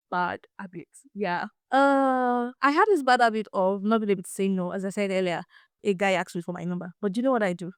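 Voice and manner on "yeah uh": normal talking voice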